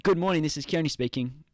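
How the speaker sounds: rising, polished receptionist greeting; answering a work call properly; formal phone greeting. Shown as a demonstration. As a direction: put on the phone voice. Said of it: "or like".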